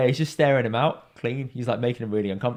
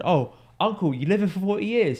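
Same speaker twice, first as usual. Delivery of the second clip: high-pitched impressed question; acted-out astonishment, pitched up; retold surprise; 8 semitones higher than usual. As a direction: imitate a friend's amazed reaction. Quoting friend's surprise at forty years.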